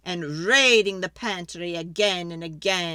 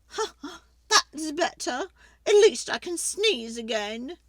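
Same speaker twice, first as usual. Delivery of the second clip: put-on high, loud complaining old lady; fretful old lady; 7 semitones higher, 4 dB louder than usual. Old lady begging the wise man for help, high and pleading.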